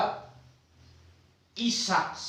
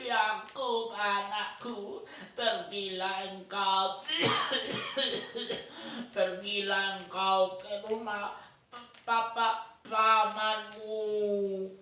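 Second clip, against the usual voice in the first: lightly played frail grandfather; old grandpa voice; 7 dB quieter than usual. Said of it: "harus yang bahasa kakek-kakek". (suara kakek-kakek yang pelan dan terbatuk)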